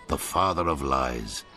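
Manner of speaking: ordinary talk, nothing marked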